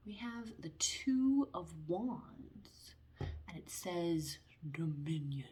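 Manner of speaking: slow, hushed whisper; card reveal whisper. Acted. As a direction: breathe the card name slowly, like a secret. Reading out the tarot card.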